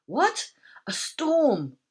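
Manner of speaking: high, alarmed disbelief; startled question, pitched high. A narrator's reading. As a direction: jolt awake in shock. Woken, startled by news.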